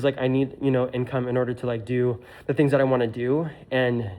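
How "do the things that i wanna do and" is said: ordinary delivery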